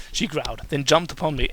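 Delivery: ordinary talk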